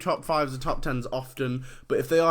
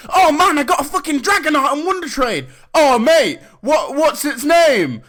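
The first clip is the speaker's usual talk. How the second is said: very high, loud, hammed-up excitement; overexcited shouting; 13 semitones higher, 10 dB louder than usual. Friend thrilled by a Wonder Trade prize, shouting excitedly. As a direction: yell it like a thrilled kid. Acts out trader receiving a Dragonite.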